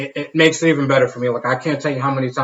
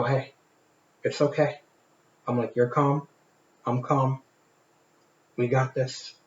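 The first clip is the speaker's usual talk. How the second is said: calm reassuring; 9 dB quieter than usual. Retold or imitated. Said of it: "And I just look at him and I go".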